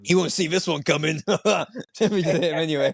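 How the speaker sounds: jokey villain aside with a chuckle; mock attacker taunts. Thug about to hit the blind guy, mock taunting.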